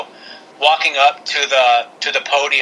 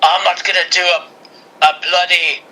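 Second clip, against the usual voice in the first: Drew, attempted Scottish accent; 3 semitones higher than usual. Podcaster imagining the wrestler refusing a king gimmick, bad Scottish accent. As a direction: have a clumsy go at a Scottish accent. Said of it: "I think there's some Scottish way back".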